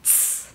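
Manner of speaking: "Tss" as a soft voiceless ts with a stream of air; exhaled C. Shown as a demonstration. Teacher showing how to exhale, "Tss" hissed softly without voice. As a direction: puff air through "Tss". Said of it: "exhalar el aire".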